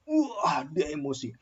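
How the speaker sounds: ordinary delivery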